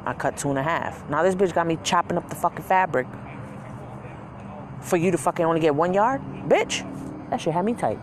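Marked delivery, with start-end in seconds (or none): none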